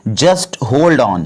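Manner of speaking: very slow, every word laid out for learners; slow, careful model sentence; slow teaching demo. Shown as a demonstration. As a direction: spell it out slowly for the class. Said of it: "तो इसको English में बोलेंगे".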